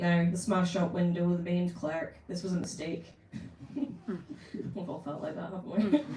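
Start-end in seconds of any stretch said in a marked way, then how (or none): 3.7-6.2 laughing aside. Real, not acted. Reader laughs aside to listeners.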